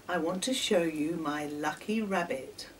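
plain reading voice

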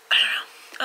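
whispering. Real, not acted. Whispered shrug before signing off.

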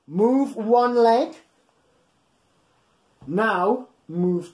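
slow, sing-song rhyme read aloud; reading to children. Acted. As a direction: read each beat out slowly for little ones.

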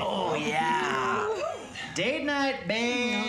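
loud triumphant whoop; excited shout. Acted. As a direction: cheer it out, gloating. Delighted about stolen gift.

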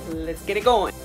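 ordinary delivery

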